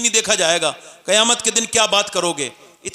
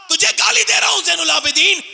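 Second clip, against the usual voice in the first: higher, louder retold shout at the imam; insulting shout; 10 semitones higher, 3 dB louder than usual. Insulter grabs the imam's collar in the Kaaba, shouting. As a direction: bellow the insult in his face.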